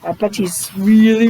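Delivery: plain reading voice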